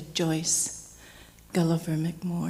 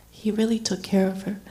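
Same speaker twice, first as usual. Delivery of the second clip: ordinary talk, nothing marked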